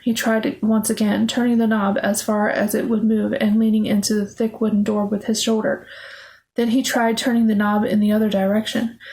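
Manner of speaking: plain reading voice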